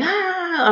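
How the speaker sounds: high-pitched mimicked "ah"; everyone's shocked "ah", imitated; mock gasp. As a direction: imitate a crowd's high, startled cry. Imitating everyone's reaction to events.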